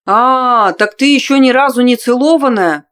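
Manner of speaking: loud, high mocking realization; mocking surprise. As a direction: tease her with sudden glee. Cad's mocking discovery.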